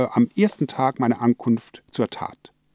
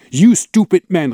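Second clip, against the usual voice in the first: loud, higher furious cry of You stupid man; angry shout; 6 semitones higher, 6 dB louder than usual. Acted. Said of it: "rief sie wütend".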